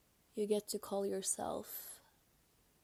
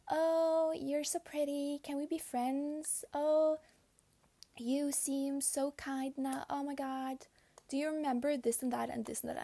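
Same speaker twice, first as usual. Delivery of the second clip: syrupy, stretched-out mock gushing; gushing mimicry; 5 semitones higher, 4 dB louder than usual. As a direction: imitate a fake-sweet girl sucking up. Imitating a girl sucking up.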